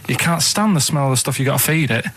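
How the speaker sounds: ordinary talk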